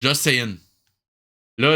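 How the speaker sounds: usual speaking voice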